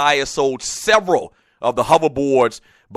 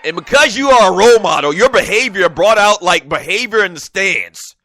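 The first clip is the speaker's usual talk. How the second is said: drill sergeant voice; 6 semitones higher, 7 dB louder than usual. Retold or imitated. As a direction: read it like a barking drill sergeant. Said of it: "I don't know why I'm talking like a drill sergeant".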